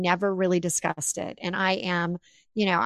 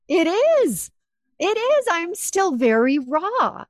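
emotional; 9 semitones higher, 5 dB louder, slower than usual. Retold or imitated. Recalling her emotional answer.

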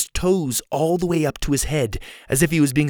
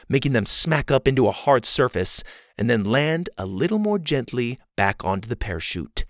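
ordinary delivery

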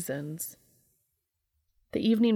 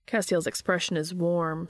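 plain reading voice

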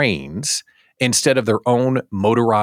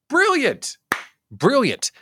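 high-pitched burst of admiration; delighted exclaiming; 13 semitones higher, slower than usual. Real, not acted. Host cheering a clever tattoo promotion, delighted exclaiming.

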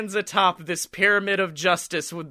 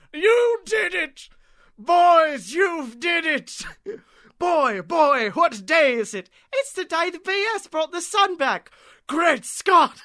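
high, slow ecstatic shouts; overjoyed old man; 10 semitones higher, 3 dB louder than usual. Retold or imitated. Grateful old man cheers the boys, high ecstatic shouting. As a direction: burst with joy at the sun's return. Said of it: "he's like".

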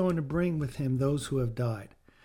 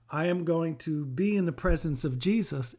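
normal talking voice

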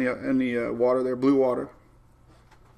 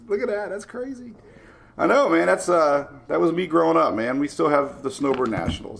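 usual speaking voice

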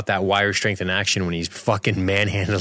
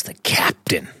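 whispering; 4 semitones lower, slower than usual. Real, not acted. Whispered aside.

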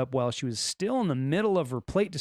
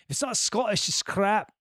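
higher, hammed-up Scottish accent; 5 semitones higher than usual. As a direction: put on a mock Scottish accent. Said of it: "Scottish accent".

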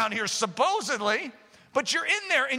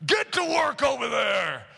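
drawn-out gruff shout; gruff burly foreman; slower than usual. Acted. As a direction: holler like a burly, gruff boss. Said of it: "this is the way he talks".

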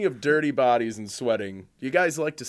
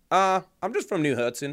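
higher-pitched imitation southern accent; 7 semitones higher than usual. Imitating a friend saying where he's from, mock southern accent. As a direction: answer in a put-on southern twang. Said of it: "has a full-fledged southern accent".

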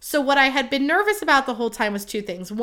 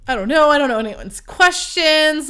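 loud, higher bratty outburst; 5 semitones higher, 5 dB louder than usual. Retold or imitated. Maid of honor fending off everyone's questions, bratty. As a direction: whine back like a little brat. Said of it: "being such a little brat".

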